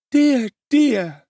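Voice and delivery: high, loud fussy woman lamenting; a matron wringing her hands; pious sigh. A narrator's reading. Mrs Sowerberry lamenting to the ceiling, high pious sigh. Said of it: "piously raising her eyes".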